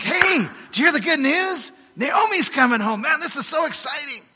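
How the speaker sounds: excited villagers spreading the news; excited townsfolk. Retold or imitated. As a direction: squeal the good news like the whole town is buzzing. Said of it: "And everyone's like".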